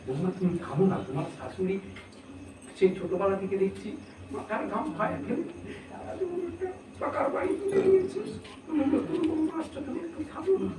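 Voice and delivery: soft, pleading voice with pitch rising high; a neighbourhood elder pleading softly with the bride; coaxing village leader. Retold or imitated. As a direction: softly coax the young bride to calm down. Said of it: "বৌমাকে বলছে".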